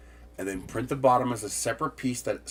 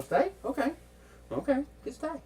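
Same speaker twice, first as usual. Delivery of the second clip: high coaxing voice. Real, not acted.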